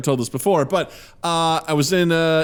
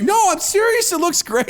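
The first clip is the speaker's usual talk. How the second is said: high-pitched imitated insistence; mock-earnest reassurance; overly earnest; 4 dB louder than usual. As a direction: insist sincerely in a much higher voice. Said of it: "he's so earnest".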